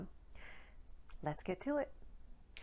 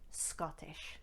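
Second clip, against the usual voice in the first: Scottish accent; 4 semitones lower, 9 dB quieter than usual. Shown as a demonstration. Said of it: "Today's accent".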